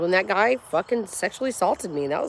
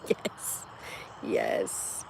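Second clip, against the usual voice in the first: breathy, quiet whisper of triumph; whispered yes; 14 dB quieter than usual. Real, not acted. Streamer savoring the moment, whispering. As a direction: whisper it to yourself. Quiet celebration after laughing.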